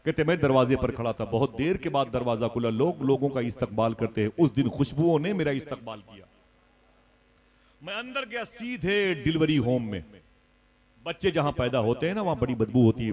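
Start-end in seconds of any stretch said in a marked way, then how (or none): none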